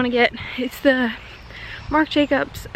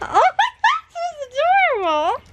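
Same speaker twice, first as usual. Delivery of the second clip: gushing adorable; 5 dB louder, faster than usual. Real, not acted. Gushing at the baby.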